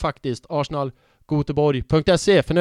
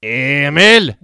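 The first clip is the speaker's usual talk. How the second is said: mock Småland accent; 8 dB louder, slower than usual. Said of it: "småländska, där pratar man lite grann så här".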